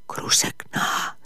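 slow hushed calling of a name; a soft, slow whisper of the name. Acted. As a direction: call her name under your breath. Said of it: "φώναξε".

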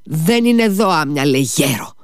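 escalated angry outburst; angry shout. A narrator's reading. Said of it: "του φώναξε θυμωμένα ο Ιβάν".

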